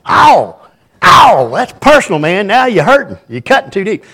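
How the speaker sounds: high, loud hammed-up cry of hurt; mock wounded. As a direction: ham up being stung by a friend's jab. Joking reply to friend's insult.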